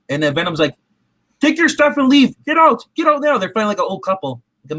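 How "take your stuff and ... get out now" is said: higher, louder bossy get out; mock angry order. Venom ordering Eddie out of the apartment, hammed-up anger. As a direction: kick him out like an angry spouse.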